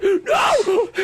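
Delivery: long, high mock-anguished scream; Matt Damon. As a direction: wail it out in exaggerated despair. Imitates Matt Damon's second scream.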